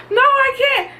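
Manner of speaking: high pleading no; mock panicked whine; whiny refusal. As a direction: refuse like a scared child. Arguing with mom in labor.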